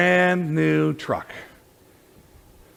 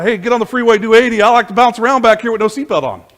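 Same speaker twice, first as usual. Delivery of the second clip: louder, higher whooping party voice; a hint of a wild joyrider egging the driver on; reckless backseat rider; 7 semitones higher, 9 dB louder than usual. Preacher voicing us as reckless backseat riders, mock whooping.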